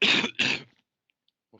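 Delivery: apologising between coughs; hoarse after a cough. Real, not acted.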